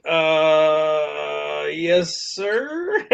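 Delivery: drawn-out uh, then a quiet, questioning sir; reluctantly agree, unsure it is allowed; uncertain, softly rising reply; hesitant compliance. Retold or imitated. Reluctantly obeys the boss's improper order.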